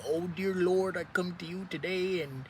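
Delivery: high, soft, put-on churchy voice mumbling a prayer; mock posh prayer voice. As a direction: pretend to be a prim holy person praying. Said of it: "talking in some weird accent like you [name]".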